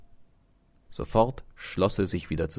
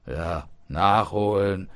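mildly angry, slow and loud; drawn-out irritated retort; angry father; 5 dB louder, slower than usual. A narrator's reading. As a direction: echo it back with slow, scornful annoyance. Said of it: "sprach der Vater zornig".

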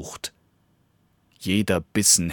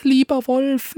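pleading little dog; 5 dB louder than usual. Acted. Little dog pleads with the wolf, high and coaxing. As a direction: coax the wolf in a tiny high voice.

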